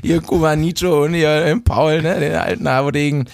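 high, upbeat welcome to listeners; show host intro. Acted. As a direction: open the show like a radio host. Podcast host opening the episode.

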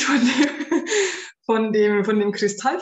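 breaking into a laugh mid-sentence; amused laugh over the words. Real, not acted. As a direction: giggle through the distraction. Distracted by rainbow light, laughing.

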